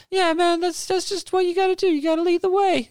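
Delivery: very high, eager rock-singer voice; a falsetto wannabe rock star; lead singer voice. Acted. A lead singer pitching his band to the player, very high and eager. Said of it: "the lead singer".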